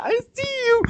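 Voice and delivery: very high falsetto taunt; prankster's creepy voice. Acted. Replaying the prank on a camper in the dark, eerie falsetto. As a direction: pipe it out as a silly spooky voice.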